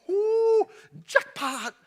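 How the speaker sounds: thrilled yelp, way up high; excited. Acted. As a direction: Naomi striking gold. Naomi hears Boaz's name.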